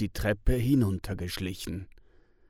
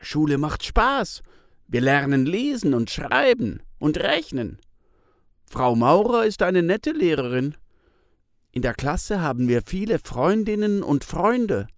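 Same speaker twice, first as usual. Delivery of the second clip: put-on higher, louder eager child's voice; a proud schoolboy telling it brightly; child Pitt; 6 semitones higher, 5 dB louder than usual. A narrator's reading. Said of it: "Pitt sagt". (Pitt schwärmt laut und hoch von der Schule)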